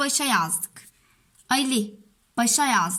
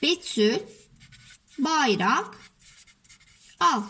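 each word alone, long gaps between; dictating while writing; slower than usual. Shown as a demonstration. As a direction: say it word by word as you write. Yazalım cümlemizi.

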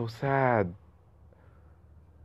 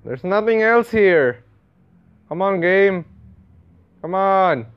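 high, loud complaint at the game; exasperated; 7 semitones higher, 6 dB louder than usual. Real, not acted. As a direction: plead with the game in frustration.

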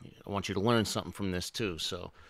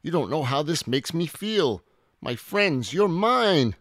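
high, loud happy outburst; elated dinosaur, rising higher and louder; overjoyed; 8 semitones higher, 7 dB louder than usual. A narrator's reading. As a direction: gush with joy, building to a shout. Overjoyed Minosaur gains friends.

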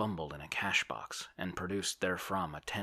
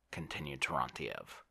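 usual speaking voice

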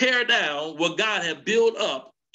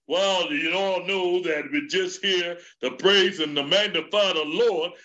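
Elder Bellinger; 2 semitones lower than usual. Retold or imitated. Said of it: "He just gets up there and he".

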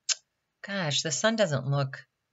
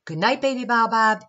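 ordinary delivery

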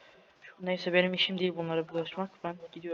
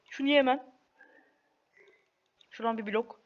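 normal talking voice

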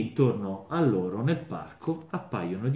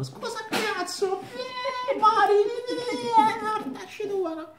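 very high, sing-song dwarf voice, cackling; tiny dwarf taunt; 4 dB louder, slower than usual. Acted. Caged dwarf jeering at passersby in a very high sing-song voice. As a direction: squeal it like a nasty little gnome.

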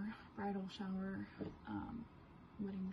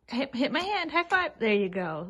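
excited dog command; 3 semitones higher, 9 dB louder than usual. Real, not acted.

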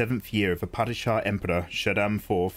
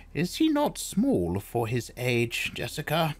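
put-on old crone voice; 6 semitones higher than usual. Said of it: "the old woman asked".

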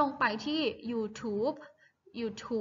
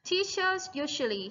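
teachers usually read slowly, clearly and a bit higher; slow model reading; 5 semitones higher than usual. Shown as a demonstration. Teacher reading aloud for her class, slow and clear. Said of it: "ประโยคที่สามค่ะ".

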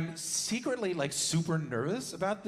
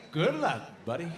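mock gruff good luck. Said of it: "That's not how you said it".

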